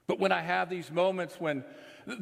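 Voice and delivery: ordinary talk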